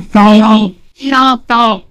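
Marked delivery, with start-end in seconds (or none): none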